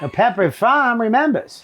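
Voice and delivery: old Cape Cod ad man, light imitation; Pepperidge Farm voice. Comparing accents, a mild imitation of the Pepperidge Farm guy's New England accent. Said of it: "Same accent".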